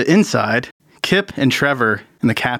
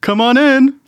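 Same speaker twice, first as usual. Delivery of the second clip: much higher, louder cheerful call; Dandridge's voice; 6 dB louder than usual. Acted. Dandridge calls visitors into his room, cheerful. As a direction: call them in brightly. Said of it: "a familiar voice say".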